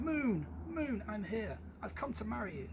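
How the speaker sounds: high eager call to the moon; calling hare. Acted. The hare calls to the moon, eager and high. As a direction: call up to her, hopeful.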